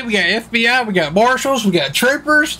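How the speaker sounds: half-laughing disbelief at the crowd; rising excitement. Real, not acted. Listing agencies, rising excitement.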